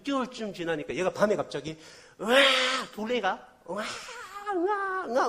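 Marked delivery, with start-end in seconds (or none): 2.2-5.3 high, loud mock baby wail; imitated baby wail. Preacher imitating the adopted baby's first cry, high loud wail. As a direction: wail like a newborn suddenly crying at night. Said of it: "울기 시작하는데".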